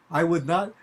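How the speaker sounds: usual speaking voice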